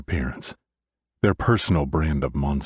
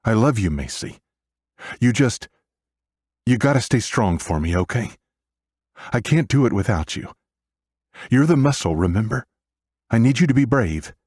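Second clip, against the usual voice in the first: higher, earnest big-brother plea; emotional urging; 6 semitones higher than usual. A narrator's reading. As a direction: urge a little sister to stay strong. Tearful brother urges sister be brave.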